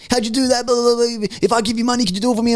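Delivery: mock excited plea to take his money; lightly voiced keen workmate; eager coworker. Coworker begs him to trade.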